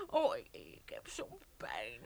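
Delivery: slow, breathless whisper of pain; faint pained whisper. Acted. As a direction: barely get the words out, hurting. Injured woman lying in pain.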